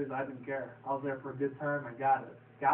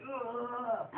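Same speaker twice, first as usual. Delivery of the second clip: high, drawn-out "ugh"; comic strained groan; 8 semitones higher than usual. Retold or imitated. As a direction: imitate a squashed thing groaning, pitched up. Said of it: "his dick was all like".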